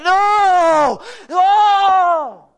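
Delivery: long, high full-voiced shout; angels crying out. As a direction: wail the word out at full volume. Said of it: "the angels are just like".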